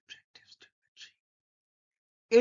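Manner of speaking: whispered, slow, no voice. Shown as a demonstration. Teacher showing students to say it silently, whispered. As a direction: breathe it out slowly. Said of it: "repeating phrase three ways".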